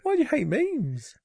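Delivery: very high, slow squeaky plea; drawn-out, high comic protest; mock dismay. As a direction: wail it in pretend hurt. Mock shock at co-host hating memes.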